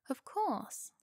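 a hint of a refined British gentleman; understated polite British accent. A narrator's reading. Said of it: "he said in a polite, almost British accent".

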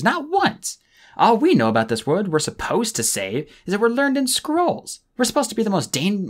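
put-on young sea dragon ranting about captivity; bursting out. A narrator's reading. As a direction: pour out every grievance at once. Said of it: "Tsunami burst in again".

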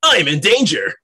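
high, loud cry of alarm; hammed-up distress call; panicked enemy pilot. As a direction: yelp it like a doomed villain. Game master voices doomed enemy.

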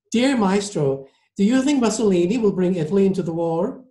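high, quick lady's voice; gushing lady passenger. Acted. Passenger asks the maestro about war, high lady's voice. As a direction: ask brightly as an admiring socialite.

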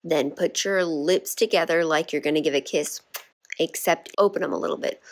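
normal talking voice

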